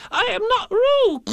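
very high, loud, slow squeal; loud protest. A narrator's reading. Piglet protesting to Kanga, loud squeaky protest. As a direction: squeal each word out loudly. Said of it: "said Piglet loudly".

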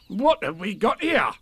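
high tiger voice weighing up a taste; curious storybook tiger; considering. A narrator's reading. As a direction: ponder aloud, high and animated. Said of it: "and considering noises".

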